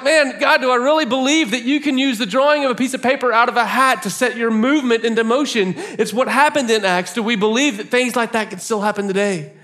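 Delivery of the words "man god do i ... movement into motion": higher, amazed plea to God; incredulous prayer. Retold or imitated. Pastor asks God in disbelief, higher and wondering. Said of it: "I'm like".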